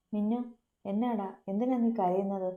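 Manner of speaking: ordinary delivery, nothing marked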